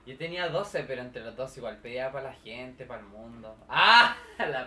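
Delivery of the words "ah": excited shriek. Real, not acted. Excited outburst about world peace.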